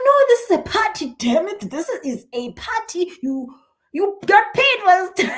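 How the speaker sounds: high, loud, laughing protest; mock outraged protest. Speaker retells protest to psychic.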